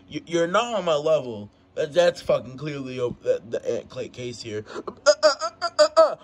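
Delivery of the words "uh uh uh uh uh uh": high, loud stammered "uh"s; mock stuttering. Fighter mocks his rival's stumbling words, mock stuttering. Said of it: "All this fucking stuttering you're doing".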